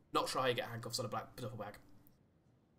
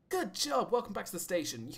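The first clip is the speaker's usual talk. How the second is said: cheery praise; 7 semitones higher, 4 dB louder than usual. Acted. Praising officer back at station.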